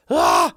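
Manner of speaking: very high, loud panicked shriek; panicked yelp. Retold or imitated. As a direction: let out a sudden scream of panic.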